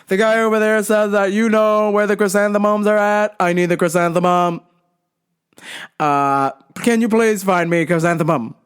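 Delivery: mock deaf man's monotone, over-projected; yelling deaf customer. Deaf customer asks worker for chrysanthemum, imitated monotone yelling. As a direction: imitate a deaf customer on one insistent note. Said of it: "he's yelling back at me".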